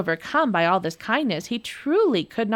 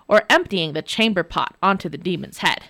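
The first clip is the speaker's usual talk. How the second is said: plain reading voice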